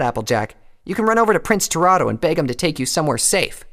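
high, loud, scornful challenge; Rainbow's scorn. A narrator's reading. Rainbow looking down on Applejack, loudly scornful. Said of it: "Her lips curled into a sneer".

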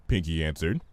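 usual speaking voice

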